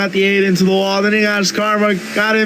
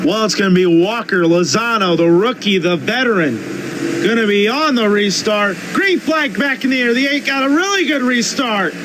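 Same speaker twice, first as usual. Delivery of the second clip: higher, race-caller voice rising with the action; live play-by-play; 8 semitones higher than usual. Acted. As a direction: call the race like a TV broadcaster.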